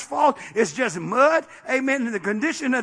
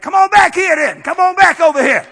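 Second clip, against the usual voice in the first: loud challenge, voice raised high; retold shout; 7 semitones higher, 10 dB louder than usual. Preacher daring a cursing man to come back, shouting. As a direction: call the heckler back, hard. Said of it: "I was gonna step out on him".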